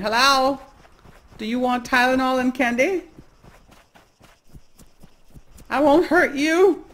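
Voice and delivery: stalk him with a high needy killer voice; serial killer voice. Pretend. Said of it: "You sound like a serial killer".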